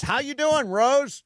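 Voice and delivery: high, booming TV host greeting; Regis Philbin. Retold or imitated. TV host greeting a grandma on air, high impression. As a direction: pitch it way up like a TV personality. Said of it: "and Reed says".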